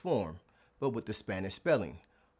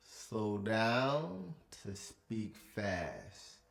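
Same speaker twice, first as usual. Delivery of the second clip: very slow, stretched out; 3 dB quieter, much slower than usual. Shown as a demonstration. Teacher modelling slow careful speech to learners. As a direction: drag each word out, slowly.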